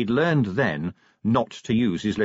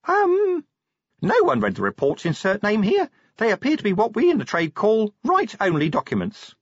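much higher, fussy little imp voice; prim little imp; 11 semitones higher than usual. A narrator's reading. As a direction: play a tiny, prissy clerk-imp clearing its throat. The imp answers Vimes.

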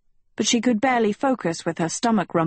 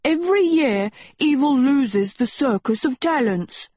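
yelling; 7 semitones higher than usual. A narrator's reading. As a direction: yell it at the class, way up. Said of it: "Sheba yelled".

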